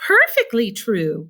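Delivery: lightly voiced grandma exclaiming; crying out as old grandma. A narrator's reading. Grandma Josephine backs up Grandpa Joe, crying out. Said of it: "cried Grandma Josephine".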